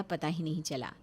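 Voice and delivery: usual speaking voice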